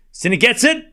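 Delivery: climbs from quick calls to a full-throated shout on the volley; rising to an excited shout. Real, not acted. Commentator calling a great point, building to a shout. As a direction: build to a roar as the point is won. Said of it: "Why are you screaming?".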